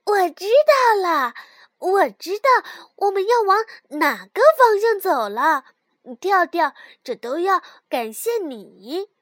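loud excited shout. A narrator's reading. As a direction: shout it out with joy. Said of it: "蹦蹦大叫起来".

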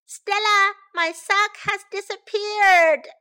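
high, loud wail to big sister; child calling out. A narrator's reading. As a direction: draw it out, high and loud, like a dismayed kid. Child calls sister over lost sock.

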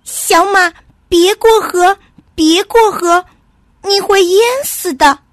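high, loud squirrel cry of alarm; urgent shouted warning. A narrator's reading. Squirrel blocks little horse from crossing, shouting in alarm. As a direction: call out to stop him, urgently. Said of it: "拦住他大叫".

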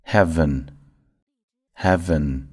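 heaven drawn out slowly, each syllable clear; slow careful heaven. Shown as a demonstration. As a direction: model heaven slowly for learners. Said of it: "第二个".

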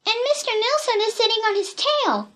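put-on high-pitched excited girl's voice; excited child. A narrator's reading. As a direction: chime in high with the discovery. Child joins in excited cry.

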